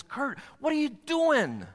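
high, exasperated scolding in questions; righteous anger. Acted. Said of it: "There's this righteous anger when he looks at me and says".

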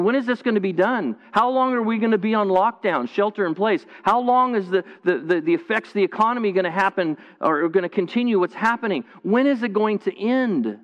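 high, pleading, worried questions tumbling out; anxious pleading questions. Pretend. Preacher voicing the worried congregation's questions, high and pleading. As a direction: ask it like someone at the end of their rope.

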